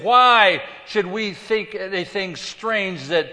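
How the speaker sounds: slow, emphatic preacher's challenge; impassioned challenge. Acted. Paul stood before Agrippa, impassioned challenge.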